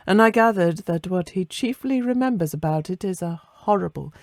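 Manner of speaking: usual speaking voice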